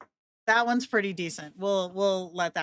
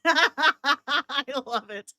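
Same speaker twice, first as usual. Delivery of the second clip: real laughter bubbling into the words, higher pitched; high, tickled laugh running into speech; delighted laughing; 6 semitones higher, much slower than usual. Real, not acted. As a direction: crack up, then say it through the laugh. Amused by the generated voice clip.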